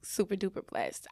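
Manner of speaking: normal talking voice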